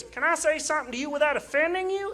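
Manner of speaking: high put-on stranger voice; imitated stranger.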